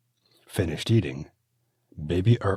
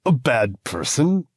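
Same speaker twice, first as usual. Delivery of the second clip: through his teeth; 9 semitones higher, 10 dB louder than usual. A narrator's reading. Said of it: "he says through his teeth".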